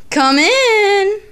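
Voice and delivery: loud call through the door. Real, not acted. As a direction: call them in loudly. Calling someone into the room.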